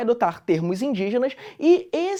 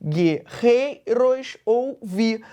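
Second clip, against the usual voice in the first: "guerreiros ouvi" very slowly, stressing "rei" and "vi"; "guerreiros ouvi" in separate beats, strong on "rei" and "vi"; weak-strong scansion; much slower than usual. Shown as a demonstration. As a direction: split "guerreiros ouvi" by syllable and lean on "rei" and "vi". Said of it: "parece que as tônicas são o rei".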